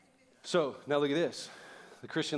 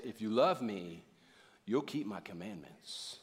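slow, low-pitched, measured demand; grave, deliberate imitation of a command; stern condition; 6 semitones lower, 4 dB quieter, slower than usual. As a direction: lay down the terms to them. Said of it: "we don't hear the tone of the voice".

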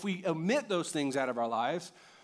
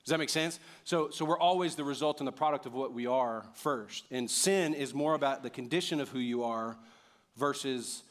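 usual speaking voice

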